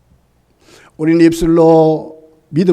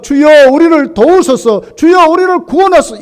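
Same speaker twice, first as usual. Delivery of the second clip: pleading prayer; 8 semitones higher, 5 dB louder than usual. Retold or imitated. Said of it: "이게 맞는데".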